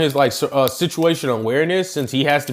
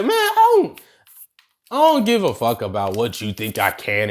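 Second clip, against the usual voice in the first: higher, fast, laughing bravado; cocky Deku; 9 semitones higher than usual. Retold or imitated. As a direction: brag with swagger and grace. Deku defiant speech to All Might.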